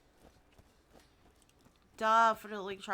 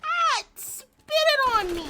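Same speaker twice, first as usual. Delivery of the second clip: loud startled cry, much higher; startled yell; 10 dB louder than usual. Real, not acted. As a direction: yelp as you're hit. Bug spits on character.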